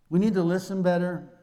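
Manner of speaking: normal talking voice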